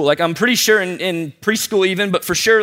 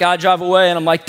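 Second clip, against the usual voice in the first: ordinary talk, nothing marked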